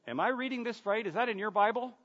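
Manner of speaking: double-check it as if you can't believe your eyes; mock disbelief. Feigns disbelief at the verse.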